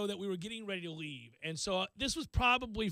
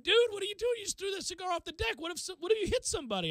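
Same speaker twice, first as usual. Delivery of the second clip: alarmed friends; 9 semitones higher, faster than usual. Retold or imitated. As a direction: yell in disbelief at a reckless buddy. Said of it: "they went".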